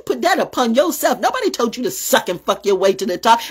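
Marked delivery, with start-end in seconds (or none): none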